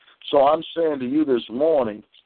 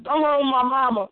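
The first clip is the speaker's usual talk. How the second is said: put-on high, pleading cry of a scared girl; girl's frightened plea; 11 semitones higher than usual. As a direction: beg like a frightened child. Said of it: "and the girl said".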